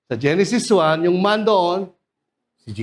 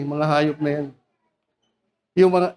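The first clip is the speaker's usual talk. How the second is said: ordinary delivery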